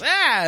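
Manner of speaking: high short yelp; startled cry. Retold or imitated. Storyteller imitating Mike trying to grab the lizard, high yelp. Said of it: "he kinda goes".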